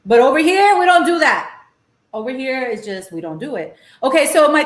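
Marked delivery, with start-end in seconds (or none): 0.1-1.6 higher and louder, firm and proud; loud insistence. Real, not acted. Telling Texan viewers how LA does it, loud and proud. Ranting against Tex-Mex, then muttering. 2.1-3.8 muttered aside. Real, not acted. Repeating the point to viewers, softly muttered. Ranting against Tex-Mex, then muttering.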